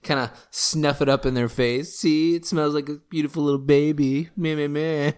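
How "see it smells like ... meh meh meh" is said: high silly mocking voice; mocking blah blah. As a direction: sneer it like a spoiled cartoon baby. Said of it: "that's another voice for the night".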